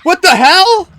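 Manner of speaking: loud, high startled yell; shocked shout. Real, not acted. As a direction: jolt at the sudden scare. Jump scare in game.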